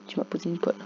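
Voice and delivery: whispered "tu m'as posé une colle". Shown as a demonstration. Gamer whispering congratulations to Akinator, hushed. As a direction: breathe it out as a whisper. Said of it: "Donc bravo".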